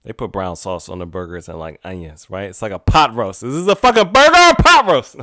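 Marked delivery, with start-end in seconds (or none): 3.4-5.2 high, loud, fast comic outburst; rushed, laughing outrage; mock indignation. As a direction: exclaim it incredulously, breaking into laughter.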